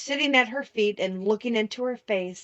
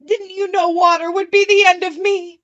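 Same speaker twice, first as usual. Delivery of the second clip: much higher, louder wailing cry; wailing, despairing; 9 semitones higher, 8 dB louder than usual. A narrator's reading. Melting witch wailing at Dorothy in despair. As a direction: despair poured into every word. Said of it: "in a wailing, despairing voice".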